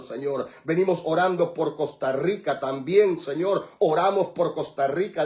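loud, unbroken intercessory praying; fervent prayer. Real, not acted. Pastor praying for towns and countries, fervent prayer. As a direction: pray blessing over the land with insistent force.

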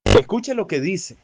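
ordinary talk, nothing marked

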